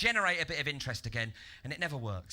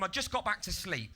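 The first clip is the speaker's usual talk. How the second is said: ordinary talk, nothing marked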